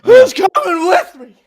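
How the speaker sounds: high, loud rallying yell; hammed-up Old School rally shout; Will Ferrell rallying cry. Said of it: "he was just Will Ferrell in Old School".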